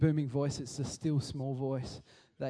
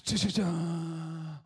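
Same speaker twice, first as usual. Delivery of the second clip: slow, drawn-out mystical sounds; mock magic chant; much slower than usual. Elisha casting the flour into the pot, mock mystical chant. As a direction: chant it like a cheesy spell. Mimics dramatic magic effect.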